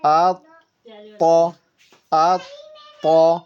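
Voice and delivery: آ then a firm stop before تو, the ط bouncing; qalqala with a stop. Shown as a demonstration. Teacher demonstrates qalqala on the doubled ط. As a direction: hold the shadda on ط firmly, then release. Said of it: "جماؤں کے ساتھ".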